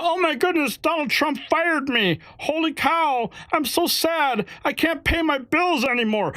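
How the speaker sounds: high mocking whiny voice; mock whining. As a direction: parody a self-pitying fired worker. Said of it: "they're whining about".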